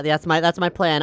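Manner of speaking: ordinary talk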